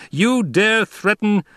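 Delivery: slow, high-pitched loud outrage; shouted. A narrator's reading. Emperor challenging Paul's threat, shouting. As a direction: shout it high, each word drawn out. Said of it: "the Emperor shouted".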